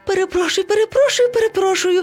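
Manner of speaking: high-pitched, pleading monkey voice; apologetic little monkey. Acted. Monkey begs pardon for an accident, apologetic. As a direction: apologize over and over, high and fretful.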